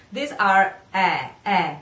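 usual speaking voice

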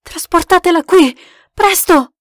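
loud, high command; yelling. A narrator's reading. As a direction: shout urgent orders to the others. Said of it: "urlava Harvey Deacon".

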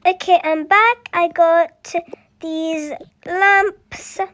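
plain reading voice

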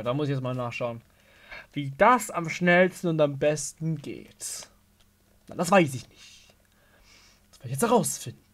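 usual speaking voice

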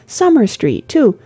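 put-on high-pitched girlish wonder; lively young lady, tickled; chatty, delighted girl. A narrator's reading.

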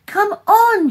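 loud, high-pitched yell; frantic shriek; desperate scream. A narrator's reading. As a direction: scream it with everything, urging them to move. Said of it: "she screamed".

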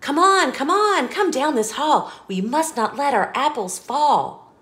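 put-on bouncy storybook reading for a child; lively read-aloud. Parent reading an apple-stacking book to a child, sing-song. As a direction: read it like a bedtime picture book, rhythmic and bright.

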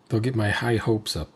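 ordinary talk

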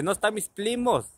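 high call, L for R in "primos"; L in place of R. Retold or imitated. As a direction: imitate a boy who turns the R in "primos" to L. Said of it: "no puede pronunciar la R".

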